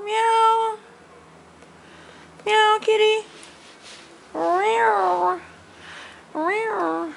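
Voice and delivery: high squeaky meow at the cat; mock cat meow. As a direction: meow back like a kitten. Meowing at the cat.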